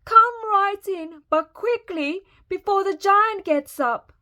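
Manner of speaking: high, loud motherly giantess; booming giantess. A narrator's reading. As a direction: usher in urgently, high and big. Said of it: "boomed the giantess".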